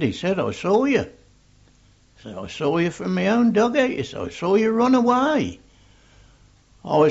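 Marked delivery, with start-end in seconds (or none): 0.4-1.1 higher, insistent captain's voice; raised, pointed assertion; retold accusation. Captain accusing him, acted quarrel. 2.4-5.6 high, raised captain's voice; higher, insistent retort; retold accusation. Captain accusing him, acted quarrel.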